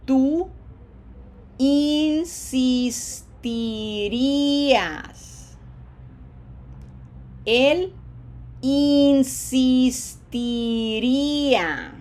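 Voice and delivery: "insistiría" stretched very slowly, loud long "ee" sounds; smiled long vowels. Shown as a demonstration. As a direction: model "insistirías" syllable by syllable with a smile. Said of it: "I have to smile for all four of these".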